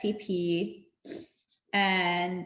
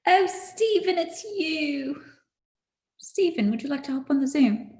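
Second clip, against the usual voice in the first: playful mock British accent, pitched up; lightly done British accent inviting him in; 6 semitones higher than usual. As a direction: greet Stephen in a put-on British accent. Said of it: "I could speak in a British accent".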